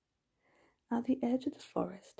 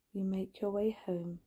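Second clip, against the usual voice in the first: usual speaking voice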